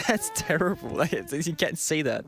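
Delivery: laughing while scolding. Real, not acted. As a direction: giggle through the words. Laughing at partner's joke.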